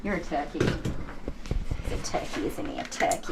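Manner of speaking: hushed, slow pet talk. Real, not acted. Talking to the dog while grooming.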